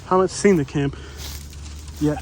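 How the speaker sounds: ordinary talk, nothing marked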